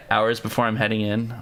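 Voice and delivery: ordinary talk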